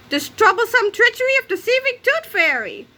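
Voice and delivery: high, dramatic announcer read of Tooth Fairy; trailer announcer. Shown as a demonstration. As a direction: sell it like an epic trailer.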